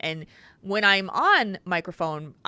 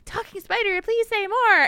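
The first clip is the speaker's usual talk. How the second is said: put-on much higher, pleading little-girl voice; three-year-old daughter; 14 semitones higher, 5 dB louder than usual. Parent imitating a daughter begging the puppet at bedtime, high and pleading. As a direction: beg like a sleepy toddler. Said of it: "she'd be like".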